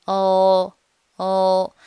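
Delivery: isolated dark l, heard as "哦"; dark l sound. Shown as a demonstration. Teacher isolating the final sound, dark l like "哦". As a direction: hold a hollow-tongue l, like "哦". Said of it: "是一个凹舌音".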